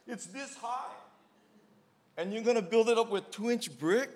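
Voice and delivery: high, disbelieving exclamation; incredulous. Retold or imitated. Telling friends about the absurd brick floor, high and incredulous. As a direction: raise the voice in disbelief. Retelling his astonishment at the job.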